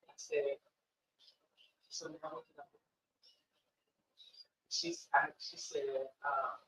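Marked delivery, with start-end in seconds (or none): 1.9-2.8 barely audible, very soft offer of help; hushed, careful retold question; gentle aftermath question. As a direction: ask it almost in a whisper. Gentle question after the client's breakdown.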